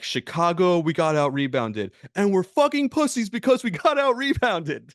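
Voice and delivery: imitating a coach working himself mad, building to a high yell; hammed-up angry coach tirade, building; Tom Thibodeau. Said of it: "he'll make himself mad".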